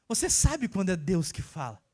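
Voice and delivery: usual speaking voice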